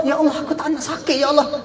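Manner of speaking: high, fast frantic plea; pleading in pain. Acted. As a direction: beg desperately, voice up high. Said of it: "minta dengan Allah".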